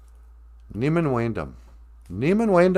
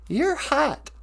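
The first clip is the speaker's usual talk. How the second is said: much higher teasing puppet; 14 semitones higher than usual. Acted. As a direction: tease in a high puppet voice. Puppet teases host.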